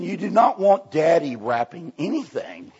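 normal talking voice

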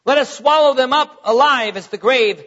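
measured pulpit reading of Proverbs; scripture reading. Acted. Preacher reading Proverbs aloud to the congregation, solemn and measured. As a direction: read the verses with weight and cadence. Reads scripture, voices sinners.

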